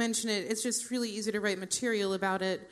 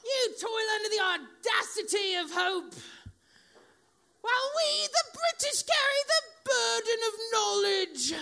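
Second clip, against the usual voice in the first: loud, high-pitched, drawn-out British outrage; yelling British husband; 10 semitones higher, 4 dB louder than usual. Retold or imitated. Mocking husband's dinner-table tirade, high British yell. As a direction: declaim it like an indignant Englishman, shouting. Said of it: "and he'll yell".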